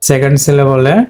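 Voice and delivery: normal talking voice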